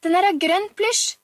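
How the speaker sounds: put-on high, loud little-girl call; child's boastful shout. A narrator's reading. Pia-Pia shouts while Oliver drags her out, boastful child. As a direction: shout the boast across the room. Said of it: "ropte Pia-Pia mens Oliver dro henne".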